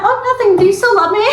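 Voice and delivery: very high, mock-innocent girlfriend voice; imitating sweet brush-off. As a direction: switch to a high put-on innocence, breaking into laughter. Imitating her sudden creepy switch.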